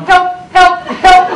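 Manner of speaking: high, loud comic yelps; mock cry for help. Passenger led off by the arm, hammed-up cry for help. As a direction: call out as if being hauled away. Acts passenger dragged away by attendant.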